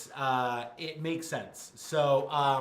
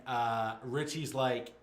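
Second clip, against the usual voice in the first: ordinary delivery, nothing marked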